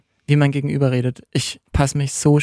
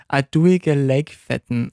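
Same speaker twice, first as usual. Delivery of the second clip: sehr langsam vorgesagtes Pinzgauer Dialektwort; „A duige Leikfetten“ gedehnt zum Nachsprechen im Pinzgauer Dialekt; Salzburger Dialekt; much slower than usual. Shown as a demonstration. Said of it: "Sag nochmal, wie heißt das?". (im Salzburger Dialekt, ganz langsam)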